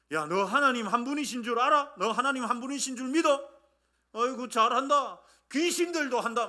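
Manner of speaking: scornful mock praise, pushed out forcefully; sarcastic. Shown as a demonstration. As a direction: taunt them with fake praise. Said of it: "비아냥대는 거예요. 비꼬는 거예요".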